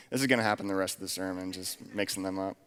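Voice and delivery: quick, low aside with a laugh; amused aside. Real, not acted. Preacher to congregation after mixing up names, amused aside. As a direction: laugh it off quietly, speeding up.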